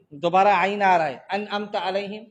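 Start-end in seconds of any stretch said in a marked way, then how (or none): 1.3-2.2 "انعمت علیہم" with both ains as plain a; wrong hamza for ain. Shown as a demonstration. Teacher imitating a faulty reading, ain lost. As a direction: drop the throat sound in "انعمت". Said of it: "صحیح ایسا ہے؟".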